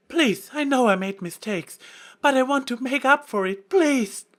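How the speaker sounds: high, loud tearful plea; desperate pleading. A narrator's reading. Trixie pleading before the goddess, high loud plea. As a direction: beg with everything you have.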